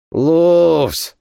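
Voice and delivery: an irritated exclamation; annoyed. Acted. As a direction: say it with real annoyance. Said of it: "с досадой проговорил Лавр".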